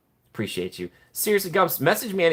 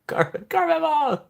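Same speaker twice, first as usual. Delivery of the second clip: high, slow excited call; slower than usual. Real, not acted. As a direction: call her name happily. Greeting a chatter excitedly.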